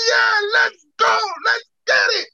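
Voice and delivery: loud fired-up shout; excited let's go. Pretend. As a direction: hype everyone up.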